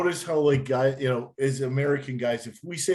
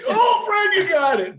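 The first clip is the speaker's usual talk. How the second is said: much higher and louder, a delighted whoop; excited cheer; 5 dB louder than usual. Real, not acted. Cheering Fred for getting it, a high excited whoop.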